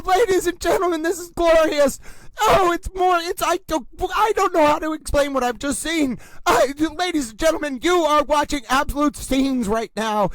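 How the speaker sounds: normal talking voice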